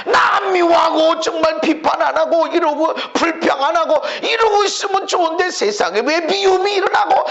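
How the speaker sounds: preacher acting out his anguish; anguished self-questioning. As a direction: tear into yourself in despair. Retelling his inner anguish.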